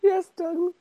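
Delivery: high-pitched little yes-man voice; meek mock assent. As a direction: squeak out an obedient yes, far above your own voice. Voicing the meek yes they expect.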